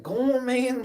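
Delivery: high, mock-stern shoo; mock shooing a dog. Reenacting shooing the neighbor's dog, high mock shoo. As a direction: wave the little dog off in a high voice. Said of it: "I'm like".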